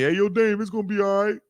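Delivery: bum yelling. Retold or imitated. Roadside man calling out to Dame Dash, yelling. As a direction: holler it across the road. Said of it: "a bum yells out at me".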